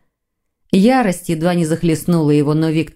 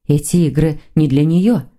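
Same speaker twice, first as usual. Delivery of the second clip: usual speaking voice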